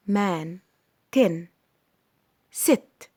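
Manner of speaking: "مان، تن، ست" each with a short vowel; snatched short words. Shown as a demonstration. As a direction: clip "مان، تن، ست", one at a time. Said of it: "كلمات مخطوفة". ("مان، تن، ست" مخطوفة)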